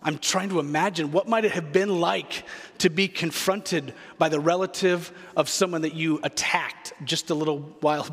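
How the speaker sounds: usual speaking voice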